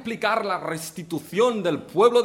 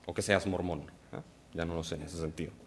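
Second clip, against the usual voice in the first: quiet joking aside; 13 semitones lower, 12 dB quieter, faster than usual. Real, not acted. Joking aside about Mormons.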